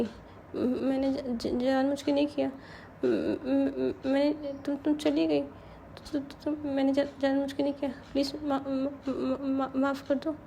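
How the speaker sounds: broken, half-whispered apology; stammering plea. A narrator's reading. Drunk girl begging forgiveness in a faltering whisper. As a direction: stutter out a tearful sorry.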